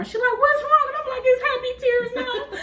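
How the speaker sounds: high, tearful crying voice; crying retelling. Retold or imitated. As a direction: tell it through real tears, voice breaking. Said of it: "And I broke down even more".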